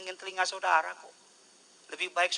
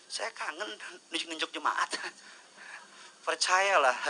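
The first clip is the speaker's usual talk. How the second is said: ordinary delivery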